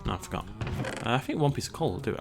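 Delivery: ordinary talk, nothing marked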